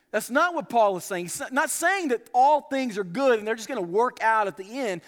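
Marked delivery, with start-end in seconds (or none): none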